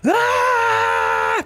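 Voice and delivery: a sudden, piercing cry of anguish, very high; anguished scream. Acted. Alphonse screams in trauma, seeing his friend fall. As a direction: let out a raw scream as your friend falls. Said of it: "puis je hurle".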